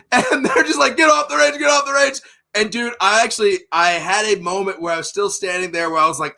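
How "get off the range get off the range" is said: high-pitched, repeated command; mock urgent yelling. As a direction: imitate staff clearing the range. Range staff ordering everyone off.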